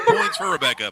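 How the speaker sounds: high, loud, laughing shout of praise; laughing cheer. Real, not acted. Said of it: "I appreciate the fact".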